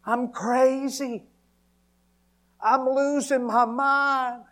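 high, loud anguished screaming, drawn out; full-force cry of breakdown; reenacted desperate scream. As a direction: scream to God with everything in you. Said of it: "screaming out with everything that was in me".

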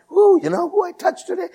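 high, quick excited fan voice; starstruck bragging. Retold or imitated. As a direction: gush it like a celebrity spotter.

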